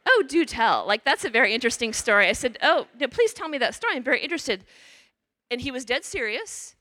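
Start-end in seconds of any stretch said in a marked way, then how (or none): 0.1-2.3 louder, exaggerated curiosity; mock eager interest. Nun answering the man who forgives nobody, overly eager. 2.6-4.6 louder, exaggerated curiosity; mock eager interest. Nun answering the man who forgives nobody, overly eager.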